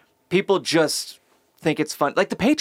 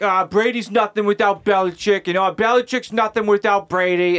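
higher-pitched Boston sports-fan parody; imitation Boston accent, pitched up; mock Boston accent; 5 semitones higher than usual. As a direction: argue like a hammed-up Boston fan. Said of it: "in a Boston accent".

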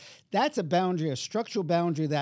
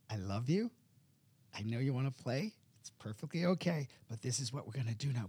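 soft, lowered reassuring voice; gentle parent; 3 semitones lower, 7 dB quieter than usual. Acted. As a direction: reassure a child softly while holding the boundary. Gentle parent voiced, contrasting the angry.

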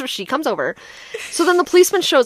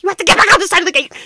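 urgent order; 6 semitones higher, much faster than usual. Retold or imitated. Acted panicked order to friend.

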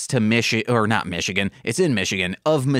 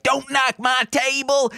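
high mock cry; 10 semitones higher than usual. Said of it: "they're like".

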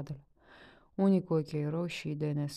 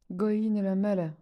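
louder, higher cry over lost hens; anguished cry; 4 semitones higher, 6 dB louder than usual. A narrator's reading. Anica cries out to the village crowd, louder and higher. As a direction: wail it out louder for the stolen birds. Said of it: "strigă Anica".

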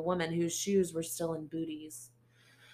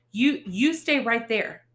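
slow, loud, panicky stammer; stammering warning to a strange boy; frightened stammer; 7 semitones higher, 10 dB louder than usual. Acted. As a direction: back away, voice shaking out loud. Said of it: "I stammered".

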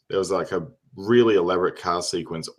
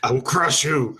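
barbarian; 6 semitones higher than usual. Pretend. Mock threat of crushing rivals.

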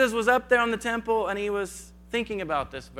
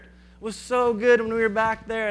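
mock whining Israelites; 3 semitones higher, 4 dB louder than usual. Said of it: "they start to whine and complain".

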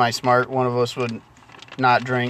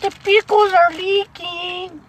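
very high, drawn-out silly voice; 우스꽝스러운 고음; slower than usual. Pretend. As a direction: squeal it slowly in falsetto. Echoes the line in silly voice.

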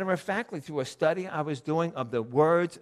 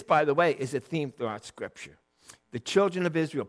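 ordinary delivery

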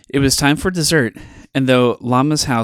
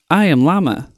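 higher llama voice, formal; Llama's voice; 6 semitones higher, 3 dB louder than usual. A narrator's reading. Llama greets his friend Alpaca in a raised voice. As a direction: state your name grandly. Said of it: "said Llama".

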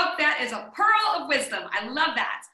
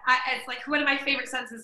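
ordinary delivery

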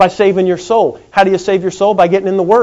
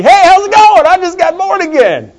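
much higher, bright mock greeting; mock cheerful high voice, louder; cheery greeting; 12 semitones higher, 6 dB louder than usual. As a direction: chirp it up high like a risen man. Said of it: "told Aunt Susie".

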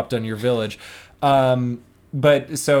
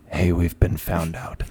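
low villain voice; 7 semitones lower than usual. Retold or imitated. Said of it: "he's like".

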